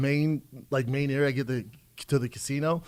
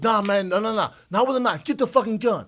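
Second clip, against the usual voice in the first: pitched up, rising imitation of the pimp; 8 semitones higher than usual. Comedian acts out the pimp demanding a gun, high mock urgency. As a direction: voice the guy calling for a gun, climbing higher. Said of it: "and he goes".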